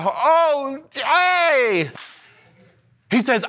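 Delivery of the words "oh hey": high, startled outburst held back; mock cussing Isaiah. Isaiah startled before the King, high mock exclamation. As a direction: bleep out a cuss in shock. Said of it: "He said".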